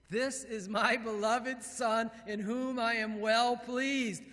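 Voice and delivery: high, loud, slow proclamation; proud God the Father. Acted. God announcing his Son, proud ringing proclamation. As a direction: declare it proudly, stretching the words. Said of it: "God the Father said that".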